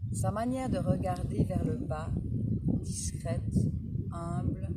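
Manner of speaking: measured Proust reading, pausing between phrases; poised recital with spaced-out words; literary reading aloud. A narrator's reading. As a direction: read it out phrase by phrase, savouring each. Reads a Proust extract aloud.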